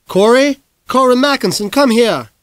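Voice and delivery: high, loud call through the house; mother summoning her son with his full name; mom calling. Acted. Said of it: "my mom called".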